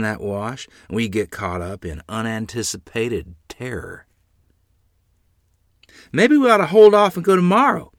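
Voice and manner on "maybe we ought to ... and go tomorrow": anxious plea, higher and louder; a worried mother urging them to wait; nervous. A narrator's reading. Mother nervously begging father to delay the trip. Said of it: "Mother said nervously".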